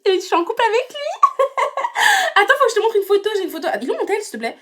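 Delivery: high giggly gush through laughter; giddy giggling; laughing. Acted. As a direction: squeal it out between laughs. Giddy reveal of new boyfriend.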